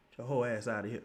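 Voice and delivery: ordinary talk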